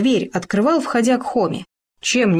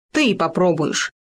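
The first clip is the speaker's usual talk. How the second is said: usual speaking voice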